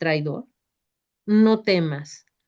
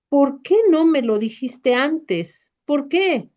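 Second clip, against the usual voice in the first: higher, louder anguished cry; shouting; 6 semitones higher, 3 dB louder than usual. A narrator's reading. Pedro shouts back at Jesús in anguish. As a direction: hurl the question at him in anguish. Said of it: "Pedro grita a su vez".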